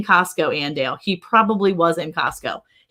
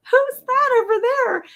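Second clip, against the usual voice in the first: mom's puzzled question; slower than usual. Retold or imitated. Mom confused at family reunion.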